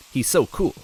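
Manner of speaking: usual speaking voice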